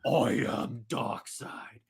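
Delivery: deep, slow supervillain voice; drawn-out, breathy villain announcing himself; Darkseid. Acted. Villain announces himself to the heroes, slow low menace. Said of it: "Darkseid goes".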